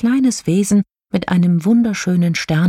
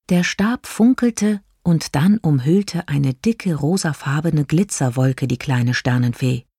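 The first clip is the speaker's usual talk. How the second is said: ordinary delivery, nothing marked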